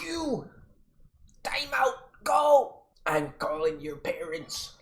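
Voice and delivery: high, loud angry teacher shout; teacher catching a kid jumping a fence, yelling. Retold or imitated. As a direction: bark out the punishment. Teacher yells at him.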